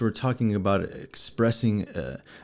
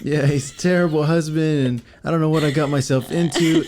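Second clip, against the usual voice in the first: mock whiny complaint; 6 semitones higher than usual. Husband mocks his wife venting about him, in a high whiny mock voice. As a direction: whine it up high like a hurt wife.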